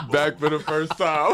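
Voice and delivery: cracking up while saying it; laughing. Real, not acted. Laughing repeat of catchphrase.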